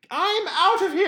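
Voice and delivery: loud, very high, hammed-up British lady voice. Imitating the girlfriend walking out, hammed-up British lady. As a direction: shriek it as a mock British woman. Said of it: "She happened to be British".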